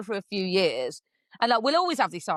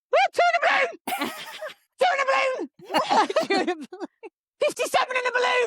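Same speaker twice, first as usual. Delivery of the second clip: high-pitched shrieks breaking into laughter; excited whooping; 4 dB louder than usual. Retold or imitated. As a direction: squeal it out like a jackpot find. Retold find, yelled in glee.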